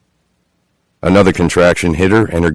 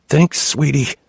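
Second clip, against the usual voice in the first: strained, forced sweetness, pitched up; tight through clenched jaw; 9 semitones higher, 4 dB quieter, slower than usual. A narrator's reading. Chloe in labour, forcing a polite thanks through the pain. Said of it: "She clenched her jaw, managing a tight".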